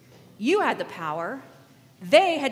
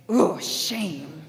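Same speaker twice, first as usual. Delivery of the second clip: low, acted scorn; mock disapproval; 4 semitones lower than usual. Crowd shaming a woman, mock disapproval. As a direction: groan out a shaming verdict. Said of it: "So you guys would say".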